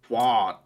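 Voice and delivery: twat said as "twot", rhyming with hot; American pronunciation. Shown as a demonstration. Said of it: "how they pronounce the word twat".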